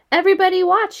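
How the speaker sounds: high, loud call for attention; crying out. A narrator's reading. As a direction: call out excitedly to everyone. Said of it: "he cried".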